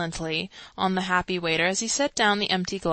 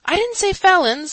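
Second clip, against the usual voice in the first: louder, higher defensive reply; louder, higher squeaky excuse; squeaky orator; 10 semitones higher, 8 dB louder than usual. A narrator's reading. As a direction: squeak out the correction. Chancellor explains to crowd.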